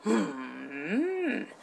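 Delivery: put-on musing old monk, low; creaky wise old man.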